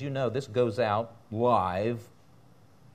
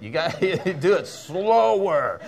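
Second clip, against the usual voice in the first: words broken by laughter, louder and slower; real laughter mid-sentence; laughing; 6 semitones higher, 8 dB louder, slower than usual. Real, not acted. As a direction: tease them through a laugh. Laughing at the zipper noise.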